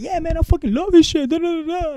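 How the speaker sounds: loud, high, fast gushing; retold enthusiasm. Telling his friend he loves the music, retold enthusiasm. Acts out own enthusiastic answer.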